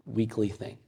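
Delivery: normal talking voice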